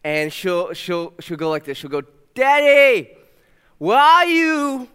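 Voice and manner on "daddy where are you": put-on high, drawn-out little girl's call; toddler calling out. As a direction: call out sweetly like a two-year-old looking for daddy. Toddler calling for her dad.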